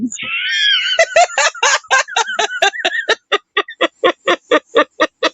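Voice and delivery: wheezing, shrieking laugh; laughing so hard it squeaks; hysterical laughter. Real, not acted.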